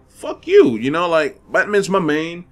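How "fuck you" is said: high-pitched mock retort; retorting to complainers. Player answering fans who complain about Batman, high mock retort. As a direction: throw it back in a squeaky-high voice. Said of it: "And I'm like".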